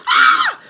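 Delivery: very high, loud shriek; scream. Acted. Mrs Twit shrieking at the frog in her bed. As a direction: shriek in fright. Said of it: "She screamed".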